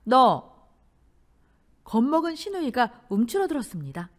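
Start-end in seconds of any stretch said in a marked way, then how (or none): 0.0-0.6 loud, abrupt challenge; sharp call-out. A narrator's reading. Bride calling out the smug sister-in-law, sharply. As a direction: pull them up short. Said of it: "시누이에게 한마디 했습니다".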